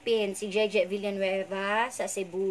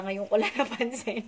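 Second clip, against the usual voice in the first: teasing laughter. Real, not acted. Host teasing viewer Sheena, laughing. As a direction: tease that ends in a long laugh.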